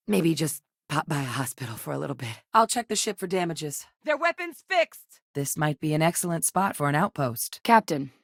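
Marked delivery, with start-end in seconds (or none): 4.0-5.2 high, stretched-out shout; combat call-out. Acted. Warning the team the weapon is fixed, shouted. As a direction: yell the warning across the fight.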